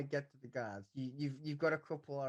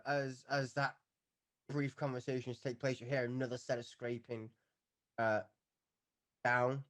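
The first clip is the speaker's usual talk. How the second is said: ordinary talk, nothing marked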